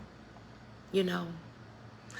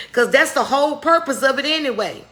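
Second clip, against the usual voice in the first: louder, rising sermon push; fired-up preaching; 5 dB louder than usual. Acted. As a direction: preach it out hard to the congregation. Preaching at full force.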